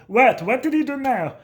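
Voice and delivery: dad brushing off the principal's complaint; dad defending son. Retold or imitated. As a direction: play the outraged father at the principal. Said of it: "he'd go over there and be like".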